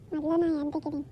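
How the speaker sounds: low, soft scheming soliloquy; menacing vow. Acted. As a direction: vow revenge quietly to yourself, after a laugh. Schemer vows revenge alone after laughing. (ತಗ್ಗಿದ ದನಿಯಲ್ಲಿ ಸೇಡಿನ ಶಪಥ)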